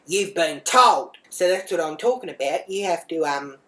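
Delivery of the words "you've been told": loud, slow, sharp telling-off; scolding shout. Retold or imitated. Mum telling off her runaway daughter, loud scolding. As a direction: lay down the law at full volume.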